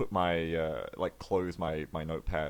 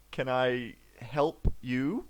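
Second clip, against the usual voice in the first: very slow, drawn-out question; awkward question; 3 semitones higher, much slower than usual. Acted. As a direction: stall awkwardly. Leaf in character.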